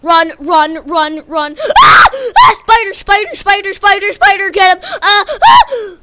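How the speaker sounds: very high, loud screams mixed with laughs; frantic laughing screams; panicked screaming. Real, not acted. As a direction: shriek in real panic. Fleeing spider attack.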